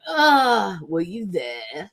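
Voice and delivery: a hint of a New Zealand accent, slow; bad New Zealand accent. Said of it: "I can't do a New Zealand accent".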